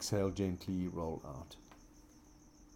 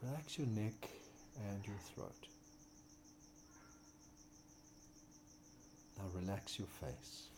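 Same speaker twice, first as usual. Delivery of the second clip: soft spa whisper; 10 dB quieter than usual. Acted. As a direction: ease them into stillness, growing softer. Guided relaxation in corpse pose.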